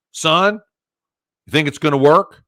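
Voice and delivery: imitated Southern gentleman, firm and loud. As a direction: play the tough old Southern boss sizing up a youngster. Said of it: "it was a Southern gentleman".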